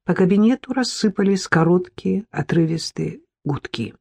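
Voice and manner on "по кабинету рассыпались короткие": usual speaking voice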